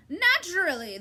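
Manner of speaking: high, loud cackled reply; cackling bird. A narrator's reading. Bird gloating at Talk, high cackle. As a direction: cackle it out smugly. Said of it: "the bird cackled".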